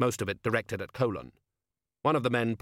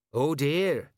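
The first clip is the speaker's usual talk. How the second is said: stagy dismay, pitched up; mugging loyally; 5 semitones higher, 4 dB louder than usual. A narrator's reading. Colon playing along with Vetinari's street act, mugging. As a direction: play along with mock dismay. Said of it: "mugging loyally".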